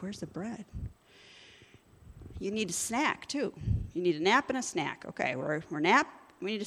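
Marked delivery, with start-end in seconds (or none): 0.0-0.7 a hushed, hungry little question; mock craving, quiet. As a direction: ask for bread under your breath as if starving. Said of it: "you're like".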